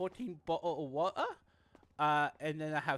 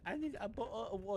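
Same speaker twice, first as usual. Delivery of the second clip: put-on British accent; 7 dB quieter than usual. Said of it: "you sound like the Queen of England".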